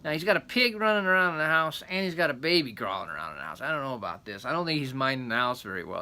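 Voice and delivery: ordinary delivery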